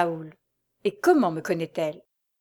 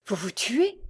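higher, rising disbelief; shocked question; 3 semitones higher than usual. A narrator's reading. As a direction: ask it aghast, pitch lifting at the end. Shocked question at suicide announcement.

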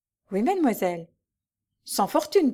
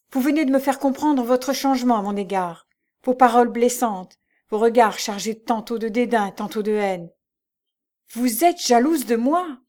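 louder, rising indignation; indignant accusation; 3 semitones higher, 5 dB louder than usual. A narrator's reading. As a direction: confront her with hurt anger. Lucie confronts jealous Mary. (Lucie accuse Mary, indignée et forte)

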